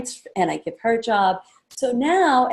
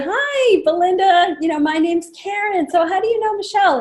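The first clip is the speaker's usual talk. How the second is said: high, louder bubbly welcome; cheery hostess hello; 9 semitones higher, 4 dB louder than usual. Shown as a demonstration. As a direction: sweep her in brightly. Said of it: "I'll say".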